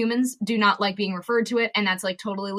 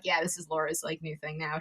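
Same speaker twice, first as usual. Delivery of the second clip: much softer, dropping low resigned aside; resigned friends; 5 semitones lower, 11 dB quieter than usual. Retold or imitated. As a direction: shrug it off under your breath. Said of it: "I think they're just like".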